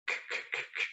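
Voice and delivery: "k" repeated as a bare unvoiced burst; voiceless k. Shown as a demonstration. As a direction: breathe out "k, k, k" with no voice. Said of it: "if you say like walk".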